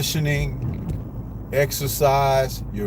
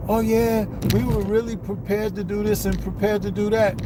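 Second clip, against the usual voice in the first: higher, sing-song mimic of a fighter bragging; mocking interview voice; 6 semitones higher than usual. Vlogger mimicking a fighter's excuses in interviews, mocking higher voice. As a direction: parody his empty claims in a raised voice. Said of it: "giving interviews".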